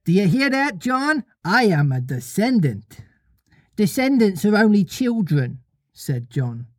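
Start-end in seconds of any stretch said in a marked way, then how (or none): 0.0-5.6 put-on high childish one-upmanship; lightly voiced boastful child; boys squabbling. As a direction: pitch it up as bragging little boys. Twin and John voiced.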